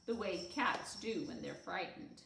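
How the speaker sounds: usual speaking voice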